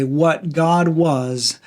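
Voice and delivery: very slow, each phrase set apart; a preacher slowing down to land the key verse; slow emphatic reading. Shown as a demonstration. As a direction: let "What God was, the Word was" land slowly, phrase by phrase. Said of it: "that's to say".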